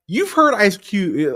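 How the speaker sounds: ordinary talk, nothing marked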